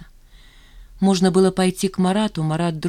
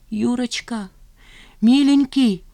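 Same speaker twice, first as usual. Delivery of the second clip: high-pitched, rising tender plea; imploring; 6 semitones higher, 4 dB louder, slower than usual. A narrator's reading. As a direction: call to him beseechingly, high. Woman pleading with her lover. (умоляюще, высоким голосом)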